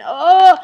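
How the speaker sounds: loud, high "oh"; mimicked contraction groan. Imitating a woman sliding down the wall in labor, loud groan. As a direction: groan loudly as if gripped by pain.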